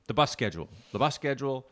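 usual speaking voice

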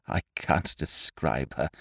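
low, slow, very soft; subdued lovelorn awe; hushed rapture. A narrator's reading. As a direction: murmur it, lost for words. Lovelorn, first take before emphatic repeat.

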